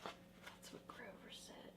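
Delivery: soft unvoiced aside; whisper. Real, not acted. Reader whispering to the child what Grover said.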